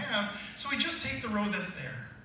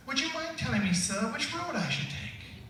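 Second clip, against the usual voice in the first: a hint of a polite British girl asking the way; British Alice; 6 semitones higher than usual. Dad reading bedtime story to his kids, light British accent for Alice. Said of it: "they wanted Alice to be British".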